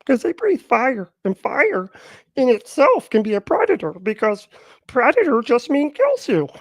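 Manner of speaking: high, loud mocking dumb-guy voice. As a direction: squeak out a dumb argument like a clueless kid. Mocking the other guy's argument.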